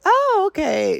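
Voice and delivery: very high-pitched re-enacted response; mock polite acknowledgement. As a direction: squeak out a polite reply, imitated. Acting out polite reaction to stranger.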